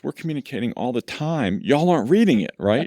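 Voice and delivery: a defensive boss who won't take the blame; mock defensive. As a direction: play your own knee-jerk defensiveness, half-joking. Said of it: "my immediate reaction is".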